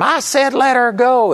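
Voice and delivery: high-pitched insistent mock yell; retold yell. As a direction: imitate a yelled command, pitched well up. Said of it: "he yelled at them".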